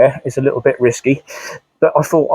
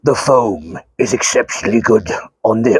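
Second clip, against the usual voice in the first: lower, smooth voice drifting into a cake advert; Darth Vader impression; 5 semitones lower than usual. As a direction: aim for Vader, slide into an ad voice. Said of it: "I've done Mister Kipling again".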